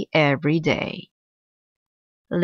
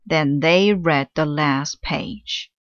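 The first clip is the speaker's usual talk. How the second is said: ordinary delivery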